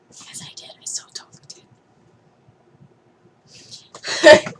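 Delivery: voiceless whispered confession. Real, not acted. Vlogger whispering that she meant it after apologising to England. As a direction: whisper the secret admission.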